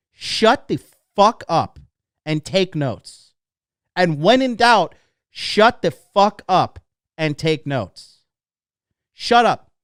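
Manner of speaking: loud, slow, emphatic order; forceful scolding. Real, not acted. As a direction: lay down the law to the listeners. Ordering listeners to stop interrupting.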